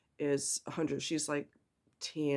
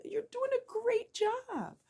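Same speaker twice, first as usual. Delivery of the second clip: slow squeaky praise, far higher; silly high praise; slower than usual. Shown as a demonstration. Owner praising the dog for doing right, high squeak. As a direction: gush up high like praising a puppy. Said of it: "which sounds silly".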